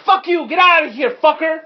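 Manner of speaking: high, loud cursing outburst; angry lady. Acted. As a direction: yell them away as an angry woman. Game master voices hostile blonde lady.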